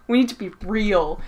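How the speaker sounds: ordinary talk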